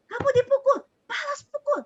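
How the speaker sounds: high, loud parent's order to hit back; imitating a parent. Teacher imitating parents telling kids to retaliate, high and loud. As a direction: mimic mum telling the child to strike back. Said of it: "mami papinya suka bilang begini".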